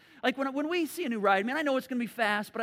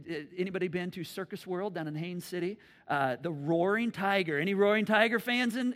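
usual speaking voice

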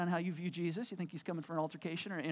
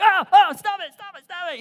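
mock shriek; 11 semitones higher, 16 dB louder than usual. Mom shrieking at squirrel puppet.

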